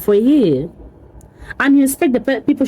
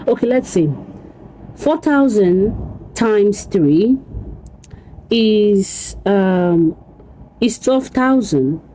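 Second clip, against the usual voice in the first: usual speaking voice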